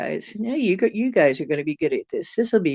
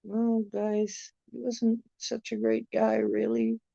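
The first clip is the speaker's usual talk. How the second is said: mild reply; 6 semitones higher, 7 dB quieter than usual. Retold or imitated. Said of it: "And I'm like".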